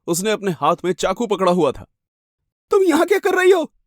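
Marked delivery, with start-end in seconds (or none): 2.7-3.7 very high, piercing demand; madwoman confronting. Acted. Crazed woman with a knife confronting a stranger, high and threatening. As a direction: accuse her in a high crazed voice.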